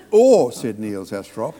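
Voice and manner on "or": sudden high loud Or; bursting in. Retold or imitated. Actor eagerly pitching a new idea to the director, high and loud.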